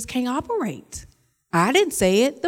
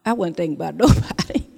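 laughing; 7 semitones lower, faster than usual. Retold or imitated. Quoting friend's excuse, laughing.

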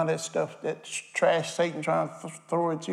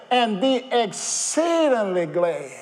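slow emphatic preaching; 7 semitones higher, 4 dB louder, much slower than usual. Acted. Drawn-out preaching climax.

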